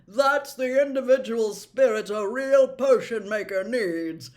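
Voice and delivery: put-on loud, beaming old professor; delighted praise; happily. Slughorn praising Harry's bezoar, happy and loud. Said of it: "said Slughorn happily".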